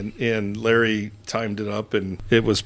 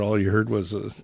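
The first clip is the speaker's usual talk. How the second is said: usual speaking voice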